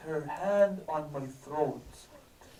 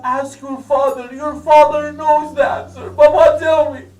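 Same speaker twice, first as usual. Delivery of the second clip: much higher and louder climactic sermon, almost sobbing; preacher wailing out the climax, high and louder; preaching at full force; 10 semitones higher, 21 dB louder than usual. Acted. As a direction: cry the story out to the crowd at full force.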